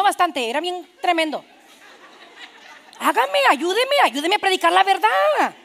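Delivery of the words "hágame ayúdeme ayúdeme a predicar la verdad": high, raised preacher's plea; emphatic, high-pitched begging; exclaimed appeal. Real, not acted. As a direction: call out to the congregation for help.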